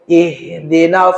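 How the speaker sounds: slow "Ihdinas" with a clear open a; correct ihdinas. Shown as a demonstration. Teacher modelling the right vowel for students, slow and careful. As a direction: draw out "Ihdinas", keeping the a thin and open. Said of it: "bukan nos".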